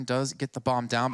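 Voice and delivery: ordinary delivery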